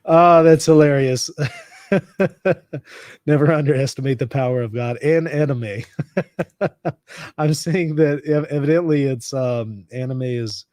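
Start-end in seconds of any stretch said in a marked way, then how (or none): 1.9-3.0 high, loose chuckle; amused giggle bubbling out; real laugh. Real, not acted. Streamer tickled by the live chat, laughing. 5.9-7.3 high, amused chuckle; real laugh. Real, not acted. Streamer laughs after reading an anime joke, laughing.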